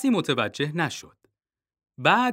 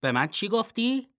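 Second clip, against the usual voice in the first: surprised; 7 semitones higher than usual. A narrator's reading. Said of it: "با تعجب به او نگاه کرد".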